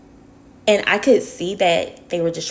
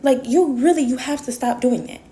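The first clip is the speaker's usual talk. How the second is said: exasperated plea; 7 semitones higher than usual. Retold or imitated. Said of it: "I'm like".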